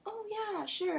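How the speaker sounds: put-on high, airy kid agreement; innocent little girl. Seven-year-old agrees to help neighbor, innocently. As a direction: imitate a trusting child saying yes. Naive child agrees, voiced in story.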